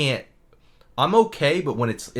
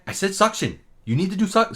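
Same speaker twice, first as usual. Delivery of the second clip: higher, mock-irritated repeat; snippy, raised-pitch retort; snipping; 7 semitones higher than usual. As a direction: imitate an exasperated dentist. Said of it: "she's, like, snipping".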